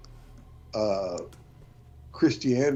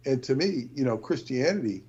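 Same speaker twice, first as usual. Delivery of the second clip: ordinary delivery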